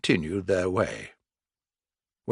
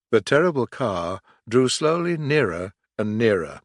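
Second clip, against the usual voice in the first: plain reading voice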